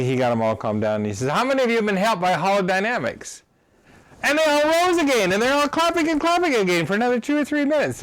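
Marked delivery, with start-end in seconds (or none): 1.3-3.4 rousing question. Retold or imitated. The leader's question, voiced in the story. 4.2-8.0 high, loud and fast, carried away; excited retelling. Real, not acted. A speaker reliving a standing ovation, high, fast and excited. Recalls the crowd applauding again.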